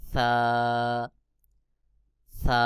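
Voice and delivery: সা as a long "tha", tongue tip on upper teeth edges; tongue to the teeth tips, stretch সা; letter demonstration. Shown as a demonstration. Teacher shows Arabic tha, সা lisped and held. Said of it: "জিহ্বার আগা সামনের উপরের বড় দুই দাঁতের আগার".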